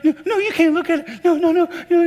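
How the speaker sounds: very high, loud mock child's panic; protesting little kid. Five-year-old with a skinned knee refusing a look, mock panicked protest. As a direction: squeal like a kid guarding a hurt knee.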